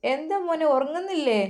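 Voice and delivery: lightly voiced mother checking on him; mother asks her son why he isn't sleeping; mother's voice. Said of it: "എന്നാൽ ഉമ്മ".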